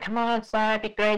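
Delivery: high-pitched coaxing masseuse voice; massage worker. Retold or imitated. Masseuses luring a passer-by inside, high mimicked voice. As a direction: put on a high, wheedling sales pitch. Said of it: "they're like".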